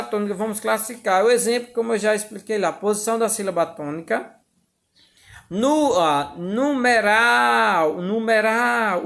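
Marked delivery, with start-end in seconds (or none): 6.4-9.0 "numeral" chanted twice with a long RAL; stress on last syllable. Shown as a demonstration. Teacher classifying the word for students, singsong "numeral" with stretched RAL. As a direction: draw out the final RAL of "numeral" each time. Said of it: "ural, farei mais forte".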